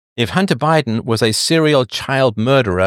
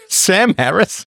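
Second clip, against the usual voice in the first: higher, drawn-out name through laughter; laughing in disbelief; 7 semitones higher, slower than usual. Real, not acted. Reading a viewer's suggested guest, laughing in disbelief. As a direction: crack up on the name.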